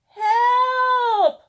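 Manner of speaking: put-on a child calling for help from far off; a stuck kid shouting help; cry for help. A narrator's reading. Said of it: "cried a child off in the distance".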